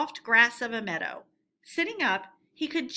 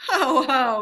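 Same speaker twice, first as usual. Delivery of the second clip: loud, gloating ho ho; gleeful laugh; 2 semitones higher, 8 dB louder than usual. A narrator's reading. Toad laughing at his escape, loud and gloating. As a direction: laugh in self-admiration. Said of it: "he cried, in ecstasies".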